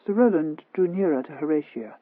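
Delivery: ordinary delivery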